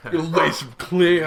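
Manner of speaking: slow, laid-back bro voice; gym bro. Pretend. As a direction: drawl it like a lazy bro. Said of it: "I use the bro voice".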